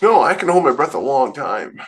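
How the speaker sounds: ordinary talk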